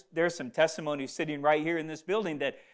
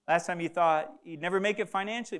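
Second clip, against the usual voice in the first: ordinary talk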